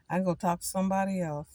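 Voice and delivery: usual speaking voice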